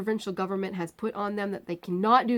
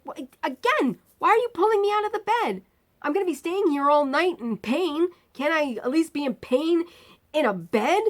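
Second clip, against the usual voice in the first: much higher and louder, fast at first; retold exasperated protest; 9 semitones higher, 4 dB louder than usual. Patient protesting to the staff taking away her bed, exasperated. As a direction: plead loudly, exasperated.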